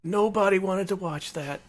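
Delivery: put-on slow, high old-timer reminiscing; shaky old voice. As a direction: grumble like a creaky old wrestler. Old wrestler voiced after "he's so old.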